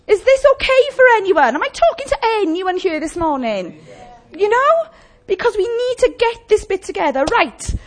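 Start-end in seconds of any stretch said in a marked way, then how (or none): 0.1-3.8 high, loud, urgent pleading to the church; impassioned preaching. Acted. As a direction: preach it out, every line pressing. Preaching at full force.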